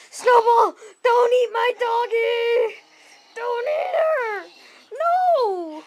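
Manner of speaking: high pleading cry, slow; panicked pleading. Acted. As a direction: beg the dog in panic. Owner begging dog not eat.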